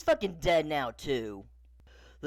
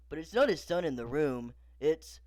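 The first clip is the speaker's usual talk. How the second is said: ordinary delivery, nothing marked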